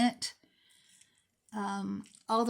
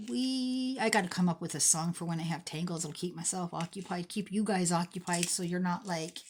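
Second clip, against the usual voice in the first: ordinary delivery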